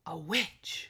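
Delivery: hushed, whispered story reveal; ominous whisper. A narrator's reading. Host revealing who lives in the castle, ominous whisper. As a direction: drop to a spooky whisper for the reveal.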